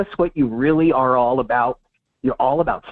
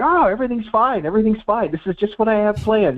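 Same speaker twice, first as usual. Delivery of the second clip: higher, falsely calm dictator insisting all is fine; deluded dictator; 7 semitones higher than usual. Retold or imitated. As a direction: play a dictator in denial, pitched up. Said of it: "He sounds like a dictator".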